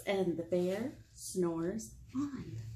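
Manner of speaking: soft, slow bedtime refrain; sleepy refrain. A narrator's reading. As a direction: hush it down like he's fast asleep. Refrain read softly and slowly.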